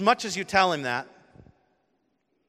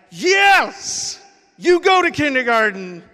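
cheering shout; 10 semitones higher, 12 dB louder, slower than usual. Retold or imitated. Cheering son off to school.

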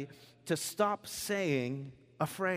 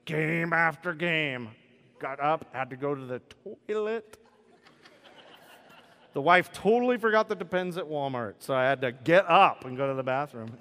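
lazy sports fan; 4 dB louder than usual. Retold or imitated. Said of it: "But they'll go".

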